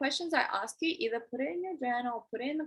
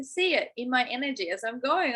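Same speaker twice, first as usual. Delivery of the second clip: ordinary talk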